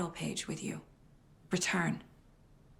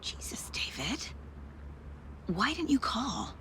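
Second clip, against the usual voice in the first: whisper; 5 semitones higher, 4 dB quieter than usual. Acted. Startled reply kept hushed.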